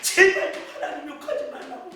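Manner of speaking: rushed hushed plea rising at the end. Retold or imitated. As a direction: hurry the whispered begging, lifting the end. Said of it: "막 그랬어요".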